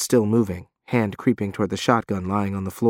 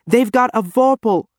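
urgent warning; 11 semitones higher, 3 dB louder than usual. Acted. Janice warning on the radio.

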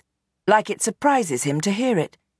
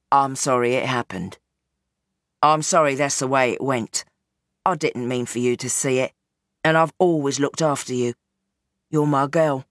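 lower, weary father pleading his case; old gangster Bernie; 4 semitones lower than usual. Acted. Gangster father explains himself to his daughter, low and weary. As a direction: sound like a tired old crime boss.

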